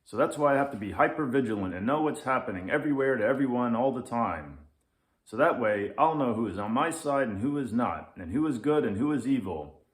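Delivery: fast, loud ranting lynx; grumpy lynx. Acted. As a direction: rant at the kid without a breath. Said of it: "says the Grumpy Lynx".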